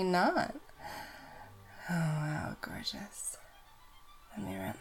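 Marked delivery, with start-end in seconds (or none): none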